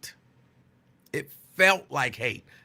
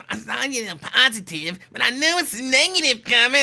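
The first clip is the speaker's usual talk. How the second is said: mock radio host complaint, higher-pitched; Lincoln Ware; 6 semitones higher, 3 dB louder than usual. Lincoln answers the caller's praise, in a mock suspicious voice. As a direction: put on another man's higher voice, getting louder. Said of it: "then he's like".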